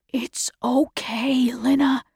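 soft, slow, near-whispered comfort; soothing. A narrator's reading. Father calms his crying daughter, soft and soothing. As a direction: reassure gently in a hushed voice.

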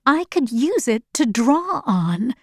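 plain reading voice

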